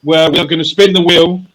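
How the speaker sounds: high, loud game-show host; show announcer. Acted. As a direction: announce the segment with big hype. Announces the show's wheel segment.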